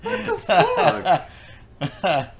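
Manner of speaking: high, drawn-out laughing what; loud laughing exclamation; laughing disbelief. Real, not acted. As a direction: stretch it out while cracking up. Reacting to the joke.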